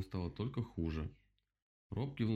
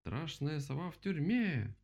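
triumphant; 8 semitones higher, slower than usual. A narrator's reading. Animals celebrate the capture.

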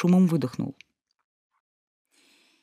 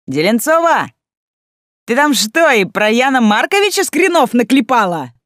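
loud mocking shout across the class; loud call; 8 semitones higher, 7 dB louder than usual. A narrator's reading. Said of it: "громко спросил Шлопаков".

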